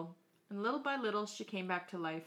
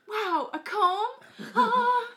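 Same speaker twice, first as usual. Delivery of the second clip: high, loud, dazzled gasp; excited girl; 13 semitones higher, 10 dB louder, slower than usual. Acted. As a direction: squeal with delight at the comb.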